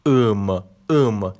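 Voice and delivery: ام ام held long and slow; drawn-out suffix. Shown as a demonstration. Teacher to students, draws out the suffix. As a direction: linger on the ending ام twice. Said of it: "بریم سراغ مورد بعدی".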